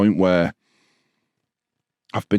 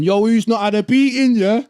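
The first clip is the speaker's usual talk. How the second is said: imitating the lads; 10 semitones higher than usual. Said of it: "they've gone".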